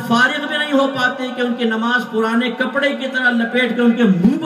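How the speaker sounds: loud, driving sermon voice; impassioned preaching. Acted. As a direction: preach it with full force to the crowd. Preaching at full force. (پرجوش خطابت، بلند آواز)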